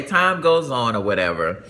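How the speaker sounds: ordinary talk, nothing marked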